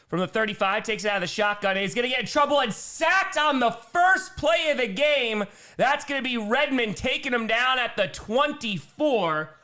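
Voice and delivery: high, excited sack call; fired-up sportscaster; live play-by-play. Real, not acted. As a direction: voice jumps up as the play breaks.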